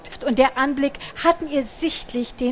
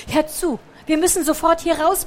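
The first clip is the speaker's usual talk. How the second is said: higher, louder urgent plea; urgent warning; 6 semitones higher, 3 dB louder than usual. A narrator's reading. Picandu urgently warning the little mouse to leave, alarmed. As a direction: hurry her out.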